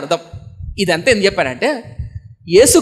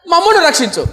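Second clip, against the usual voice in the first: high-pitched mock demand; imitated taunting demand; reviling; 9 semitones higher than usual. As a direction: act out the thief demanding rescue. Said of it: "నేను దూషిస్తూ".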